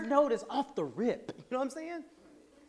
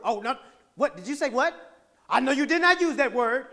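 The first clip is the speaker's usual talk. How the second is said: mock indignant; 2 semitones higher, 8 dB louder than usual. Acted offended listener popping off.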